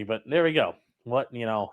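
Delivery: ordinary delivery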